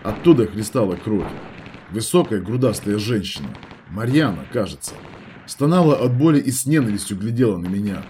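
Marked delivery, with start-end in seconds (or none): none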